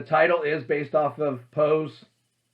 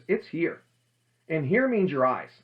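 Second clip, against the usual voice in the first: ordinary delivery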